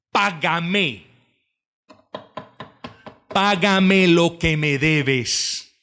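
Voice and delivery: slow, hard demand for payment; demanding debtor. Acted. Forgiven servant grabs his fellow servant, slow stern demand. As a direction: lean on each word, merciless. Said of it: "miren lo que le dice".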